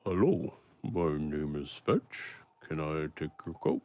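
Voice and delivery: a hint of a lumbering ogre offering to take a coat; ogre butler. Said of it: "to Mycroft".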